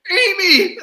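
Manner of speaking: a high, loud yelled name; mock shout. Fan reenacting a funny movie moment shouts the name.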